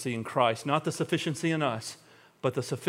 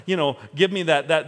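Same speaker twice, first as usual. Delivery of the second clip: ordinary delivery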